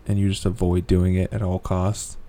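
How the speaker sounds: ordinary delivery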